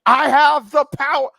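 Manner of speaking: loud, high, drawn-out hero battle cry; imitated cartoon yell. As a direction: bellow it slowly like a cartoon hero. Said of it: "he'd just yelling".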